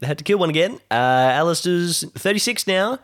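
ordinary talk, nothing marked

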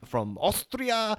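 put-on slow, drawn-out Austrian-accented "Austria". Disguised candidate tells the host where he is from, in an Austrian accent. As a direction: stretch "Austria" the way an Austrian would. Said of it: "putting on a German accent".

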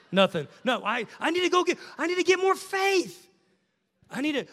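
frantic believer. Retold or imitated. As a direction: play the anxious striver grasping for faith.

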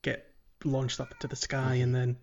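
usual speaking voice

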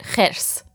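خرس whispered, final s clipped short. Shown as a demonstration. Teacher modelling the short final s for the learner. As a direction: cut the س of خرس off quickly. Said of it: "the S sound short".

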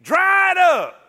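loud, slow, high dry it up; shouted command. Acted. Preacher to moping crowd, slow loud shout. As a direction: yell it like a drill order.

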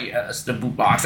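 low, soft, imitated menace; creepy. Hook confirming the pirate's punishment, creepy and low. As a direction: drop down into creepy relish. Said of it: "with this, like, really creepy".

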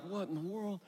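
soft, half-awake bewilderment; groggy. Retold or imitated. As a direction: mumble it like a father just woken. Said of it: "Like, groggy".

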